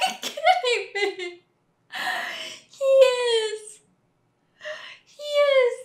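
real overwhelmed crying; crying with joy. Real, not acted. Fan seeing Luke return, crying with joy. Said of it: "I'm gonna cry".

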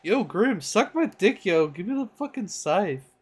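high, loud cartoon-boy impression; Irwin. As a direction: demand it in a high, swooping kid voice. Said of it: "that's Irwin, bro".

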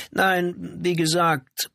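high-pitched, guarded wife; cautious wife. A narrator's reading. Janitor's wife repeats her denial to police, cautious. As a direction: pitch it up, carefully standing firm. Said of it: "bekräftigte die Frau vorsichtig".

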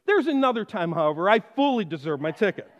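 ordinary delivery

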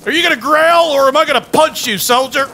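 ordinary talk

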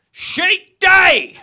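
sudden high, very loud yell; manic shout. Retold or imitated. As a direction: bellow it like an unhinged stranger. Stranger's sudden outburst, acted.

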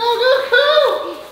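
ordinary delivery

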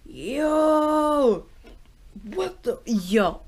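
high, loud yo of disbelief; stunned. Real, not acted. Vlogger still reeling from the ending, high stunned yo.

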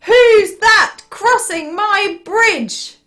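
loud, high, slow troll challenge; challenging troll. Acted. Terrible troll to little goat on his bridge, loud challenge. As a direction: boom it out slowly like an indignant troll.

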